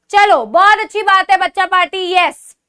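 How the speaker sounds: usual speaking voice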